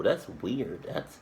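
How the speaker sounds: normal talking voice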